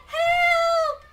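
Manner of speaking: high, loud, hammed-up shriek for help; crying out. Rabbit stuck in a trap, crying out shrilly for rescue. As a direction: cry out like a trapped rabbit. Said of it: "she cried out".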